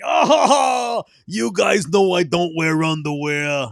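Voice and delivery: loud, high, drawn-out New York bellow; exaggerated New York accent shout; mock New York accent. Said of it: "in that stupid New York accent".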